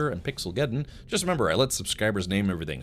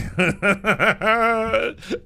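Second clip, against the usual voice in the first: laughter; 12 semitones higher, 6 dB louder than usual. Real, not acted. Laughing at the Canadian stereotype.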